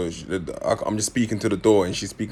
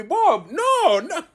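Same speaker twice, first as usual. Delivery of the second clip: put-on French accent yell; much slower than usual. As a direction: imitate panicking French siblings shouting no. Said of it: "they got the French accent".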